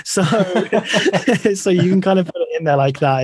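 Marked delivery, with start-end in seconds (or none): 0.3-1.5 high bright laugh at the joke; real laugh at the government jab; burst of laughter. Real, not acted. Joking about the government.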